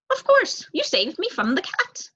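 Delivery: put-on high, eager young crow. Young crow answering the mouse, high and eager.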